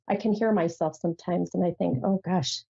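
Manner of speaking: normal talking voice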